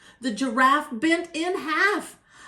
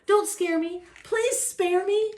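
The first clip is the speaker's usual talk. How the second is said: high-pitched panicked pleading; acted fright, pitched up high; frightened elephant; 6 semitones higher than usual. A narrator's reading. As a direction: squeal in comic terror and beg for mercy. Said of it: "shouted the elephant, jumping up in the air".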